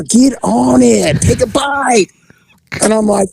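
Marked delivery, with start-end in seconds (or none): 0.1-2.1 high, drawn-out mock egging-on; lewd urging. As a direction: act out an overeager creep urging someone on. Retold lewd urging of officer.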